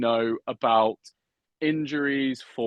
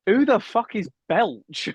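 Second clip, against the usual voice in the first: baffled, high-pitched; 10 semitones higher, 3 dB louder, slower than usual. Retold or imitated. Mock baffled about Welsh names.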